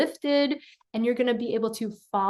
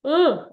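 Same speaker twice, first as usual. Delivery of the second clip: yawn-like lift sound; 4 semitones higher, 5 dB louder than usual. Shown as a demonstration. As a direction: open throaty ugh as if starting a yawn. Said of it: "You're going".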